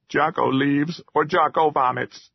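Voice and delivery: small creature digging in its heels; troll ultimatum. A narrator's reading. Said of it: "Finally he said".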